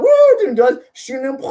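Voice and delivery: high excited woo; mock whoop.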